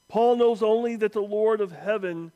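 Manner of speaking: ordinary talk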